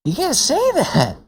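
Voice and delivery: very high, incredulous protest; real high-pitched objection; appalled disbelief. Real, not acted. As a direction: squeal it in scandalized disbelief. Appalled reaction to the clip.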